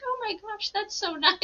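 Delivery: retelling her own reaction, high delighted gush; amazed gush. Retold or imitated. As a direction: gush at how nice she is. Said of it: "And I think".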